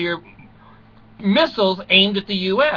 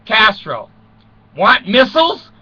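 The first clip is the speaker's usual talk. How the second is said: loud, high, pushy offer; mock Khrushchev; 5 semitones higher, 5 dB louder than usual. Khrushchev to Castro, a loud mock offer. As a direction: hawk the missiles like a salesman.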